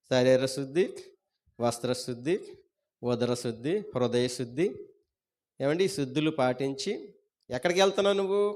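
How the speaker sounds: normal talking voice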